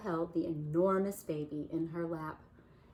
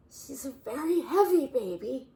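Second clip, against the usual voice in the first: high, loud strained gasp of surprise; 7 semitones higher, 7 dB louder than usual. Acted. Bear gasping as the baby sits in his lap. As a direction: gasp under the weight. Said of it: "Bear gasped".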